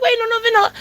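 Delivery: frantic high-pitched interjection; acted-out panicked correction; blurted out. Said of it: "I blurted it out".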